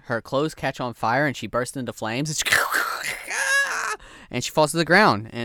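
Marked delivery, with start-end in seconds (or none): 2.6-4.3 long, very high dying shriek; witch's death screech. Acted. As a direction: screech like a witch going up in flames. Witch bursting into flames, dying.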